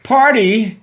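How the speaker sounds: high, loud startled question; surprised Susan. Acted. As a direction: catch your breath at the word. Susan realizing her mistake.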